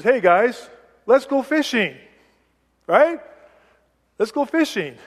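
high, bright "Let's go fishing" to the group; cheerfully rally your friends; upbeat invitation. Retold or imitated. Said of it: "It could be, Peter says".

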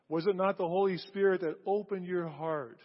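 ordinary talk, nothing marked

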